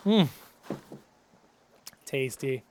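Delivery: normal talking voice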